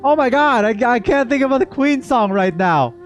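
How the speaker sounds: normal talking voice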